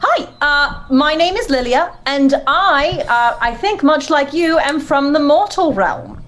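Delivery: high, polite introduction; courteous hero pitched high; polite adventurer. Acted. Player speaks as Lilia to dragon.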